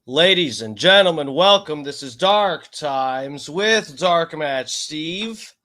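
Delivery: booming show host welcome; announcer intro. Acted. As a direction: announce it like a showman.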